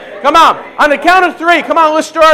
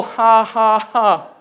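little deliberate demonstrated laugh; small starter laugh; 3 semitones lower, much slower than usual. Shown as a demonstration. Preacher showing how laughter starts off, small forced laugh. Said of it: "It just starts off like this".

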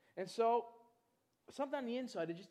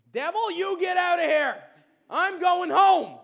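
slow, towering rebuke shouted much louder; shouting at the devil; 10 semitones higher, 19 dB louder, slower than usual. Retold or imitated. Preacher rebuking the devil, shouting him away. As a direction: command the devil out at full force.

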